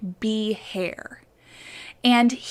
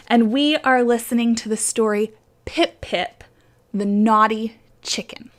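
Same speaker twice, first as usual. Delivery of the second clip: ordinary delivery, nothing marked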